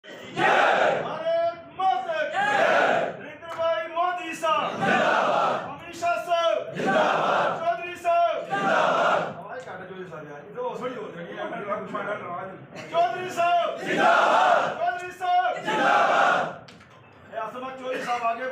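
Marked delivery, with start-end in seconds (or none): 0.0-9.2 rally slogan shouting. Real, not acted. Crowd leader shouting slogans. 12.9-16.7 high, slow, shouted slogan; rally slogan shouting. Real, not acted.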